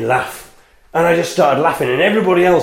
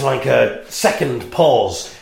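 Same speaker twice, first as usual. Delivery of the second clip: normal talking voice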